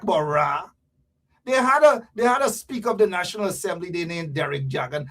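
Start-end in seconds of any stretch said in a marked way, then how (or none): none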